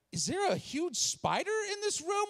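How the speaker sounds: high-pitched bewildered alarm; retold frightened disbelief; alarmed question. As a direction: ask it in rising panic. Retelling his alarmed reaction.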